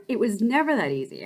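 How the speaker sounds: ordinary delivery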